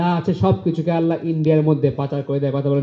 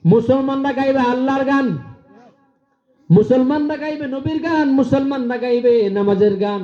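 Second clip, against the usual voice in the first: high, ringing preacher's cry; fiery preaching; 8 semitones higher than usual. Acted. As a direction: preach it out to the crowd. Preaching at full force.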